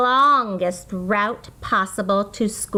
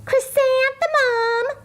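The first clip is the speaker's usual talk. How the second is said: mocking classmate. A narrator's reading. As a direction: sneer her name like a snide schoolgirl. Classmates mocking her name.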